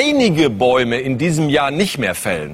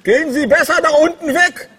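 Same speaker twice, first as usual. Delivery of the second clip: tin can phone; 13 semitones higher, 3 dB louder than usual. Pretend. Activist answering through can phone.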